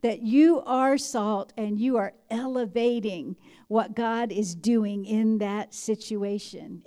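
normal talking voice

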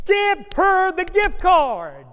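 big, slow, loud voice of God; booming divine command. Retold or imitated. Preacher booming the Holy Spirit's command to the pastor: slow and loud. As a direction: boom it out slowly like God's voice.

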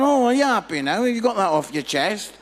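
loud, high mock question; mocking retort. Imagined people answering Jesus after preaching, loud mocking retort. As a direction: throw it out loudly as a taunt.

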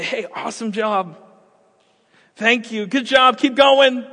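rising, cheering praise; encouraging parent. Acted. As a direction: cheer the toddler on, building up.

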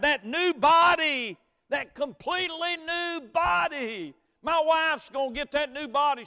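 loud, high preaching climb; full-force preaching. Acted. Pastor preaching hope at a funeral, loud and high. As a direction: preach it out to the congregation.

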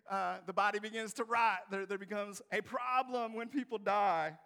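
loud, high-pitched comic protest; exasperated Martha. Acted. Preacher plays Martha explaining death to Jesus, loud and exasperated. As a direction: explain the obvious at full volume, hammed-up. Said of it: "these were real people with real concerns".

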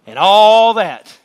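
slow loud climactic line; emphatic swell. Real, not acted. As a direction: swell up on the big point. Climax of sermon.

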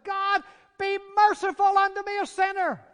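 loud, high cry for mercy; fervent prayer. Acted. Publican crying out to God for mercy.

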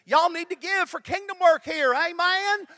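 loud, high, exaggerated Texas twang; Tammy Young. Pastor demonstrates his colleague's preaching, hammed-up Texas twang. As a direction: belt out an exhortation in mock Texas twang. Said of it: "she said it in her Texas twangy voice".

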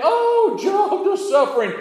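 high, loud, theatrical cries of woe and relief; mock dramatic exclamations. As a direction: ham up each twist of Joseph's story. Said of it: "My brothers threw me in a pit".

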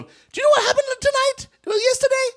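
very high, loud excited gossip; gossiping villager. Retold or imitated. Villagers passing around news about Jesus, very high and excited. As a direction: spread the news breathlessly. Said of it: "news went from".

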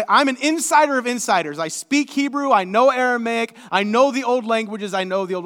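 boastful. Retold or imitated. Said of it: "he's, he's like".

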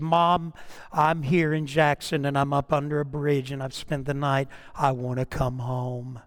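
low voice sinking to a soft close; retold tearful plea. Runaway girl asks mom to come home, understated tearful plea. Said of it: "they were both sobbing and crying".

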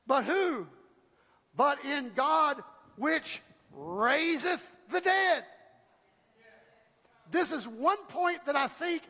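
rising, shouted preacher cadence, punching key words; preaching at full force. Acted. Pastor rallying his church, preaching at full force. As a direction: build the congregation up, voice climbing. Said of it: "Can I preach today?".

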